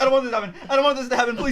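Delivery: high loud laughing hammed-up plea; mock pleading. [name] imitating his own scared plea, laughing. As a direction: beg in a mock whimper.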